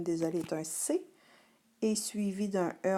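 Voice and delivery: ordinary talk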